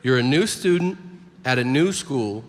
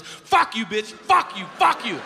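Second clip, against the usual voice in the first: high, repeated bursts of swearing; mock outburst; 6 semitones higher than usual. Boy with Tourette's at the substitute, mock explosive outburst. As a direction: fire each curse out as a high-pitched burst. Boy's escalating tic outburst at teacher.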